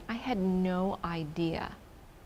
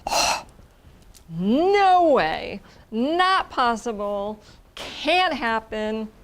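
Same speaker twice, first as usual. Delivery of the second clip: louder, slow flat no-way; dismissive refusal; 3 semitones higher, 5 dB louder, much slower than usual. Retold or imitated. The old self refusing the dream, loud dismissal. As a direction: shut the idea down hard. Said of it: "old [name] ... would have said".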